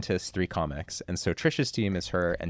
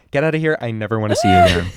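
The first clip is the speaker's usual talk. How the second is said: higher and louder, laughing through it; playful rude send-off; 6 semitones higher, 8 dB louder, faster than usual. Retold or imitated. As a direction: shoo them off with mock contempt. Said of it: "They finish, they go".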